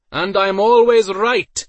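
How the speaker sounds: loud, high, slow shout back; shouted reply. A narrator's reading. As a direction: yell it through cupped hands. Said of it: "Iden cupped his hands over his mouth".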